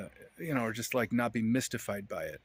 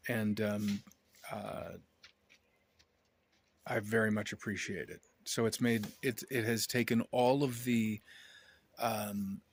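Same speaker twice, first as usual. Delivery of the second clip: normal talking voice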